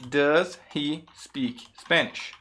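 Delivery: each word spaced out slowly, "Does he speak Spanish"; slow careful model. Shown as a demonstration. English teacher models the question for learners, slow and word by word. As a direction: say "Does he" slowly as two separate words. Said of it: "falando um pouquinho mais rápido".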